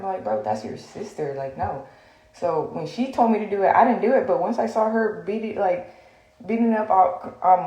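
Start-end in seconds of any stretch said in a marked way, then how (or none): 0.2-1.9 low, soft pleading; calm her down quietly. Retold or imitated. Retelling own low plea.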